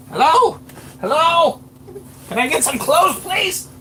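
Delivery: high squeaky little voice. Acted. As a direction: pipe up tiny and high from hiding. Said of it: "a little voice".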